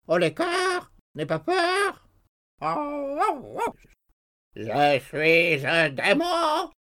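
very high, slow, loud squawking; excited raven. A narrator's reading. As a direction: screech and cackle like a wild raven. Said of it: "frappé d'une idée subite et très excité".

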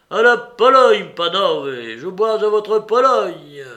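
loud, high-pitched, exuberant toast; rousing toast. A narrator's reading. Mitya drinks to Poland, loudly toasting. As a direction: proclaim it to the whole company.

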